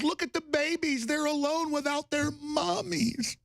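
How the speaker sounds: high-pitched, drawn-out mock pity; exaggerated sob-story voice, mocking; mock bleeding-heart newspaper. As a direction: whine it in a high put-on voice. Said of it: "the New York Times goes".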